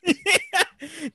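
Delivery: shrieking high laugh; high burst of laughter at the joke. Real, not acted. Laughing at the pervert line.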